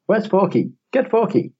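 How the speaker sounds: high excited toy voice; squeaky high pull-string toy; toy voice line. Retold or imitated. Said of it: "It's just like".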